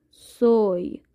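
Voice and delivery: سوی said as Spanish soy, one clear syllable; Spanish soy demo. Shown as a demonstration. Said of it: "الان براتون مینویسم". (سوی را اسپانیایی تلفظ کن)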